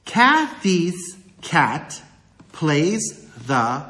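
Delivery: slow, separated syllables for counting. Shown as a demonstration. Teacher putting example words together, slowly syllable by syllable. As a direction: draw out each syllable, pausing between words. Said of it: "let's put those words together".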